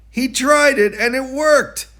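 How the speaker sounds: high, loud burst of delight; triumphant. A narrator's reading. Narrator celebrating George riding on one wheel, triumphant. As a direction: cheer his clever idea.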